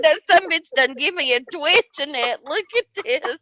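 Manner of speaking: high, exasperated griping; a put-upon hillbilly gripe; griping complaint. Retold or imitated. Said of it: "I said".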